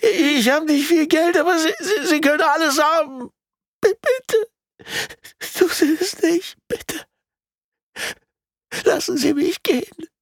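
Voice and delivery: tear-choked pleading in a very high voice; high, loud, crying victim pleading for life; Sean. A narrator's reading. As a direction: beg desperately through sobs, stammering. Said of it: "flehte Sean mit tränenerstickter Stimme".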